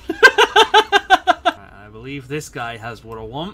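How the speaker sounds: slow, loud laughing delivery. Real, not acted. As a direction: laugh through the line. Pleased with loot.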